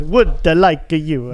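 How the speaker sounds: slow British tourist English, "uh" tacked on each word; mock a Brit in France, dragging out each word; British abroad. Teasing co-host about British people in France, mock foreigner-speak. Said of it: "can't just put "uh" on the end of every word".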